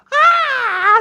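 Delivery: high, loud mock scream; sudden piercing yell, imitating a startled aunt. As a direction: let out a big imitated shriek of fright. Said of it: "Pegó un grito".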